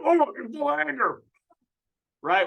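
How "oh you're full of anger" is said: high, fast mock scolding; mocking host. Mocking the host brushing off Brian, high and fast. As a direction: toss it off in a high mocking whine. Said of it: "And just".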